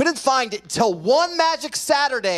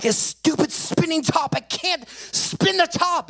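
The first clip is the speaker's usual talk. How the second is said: high, strained tantrum whine; frustrated child; 11 semitones higher, slower than usual. Retold or imitated. Boy complains to mom about the top, frustrated outburst. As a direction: act out a kid's exasperated outburst. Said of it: "anger and frustration starts to mount up".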